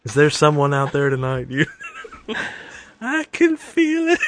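slow, hammed-up late-night DJ voice; a pretend romantic radio host; mock radio DJ. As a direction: croon it like a sappy dedication host.

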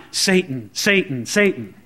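low, evenly spaced repeated name; flashing sign. Acted. Pastor mocking Peter's supposed inner message, flashing sign voice. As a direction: intone it low, like a blinking warning. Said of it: "it was flashing in his mind".

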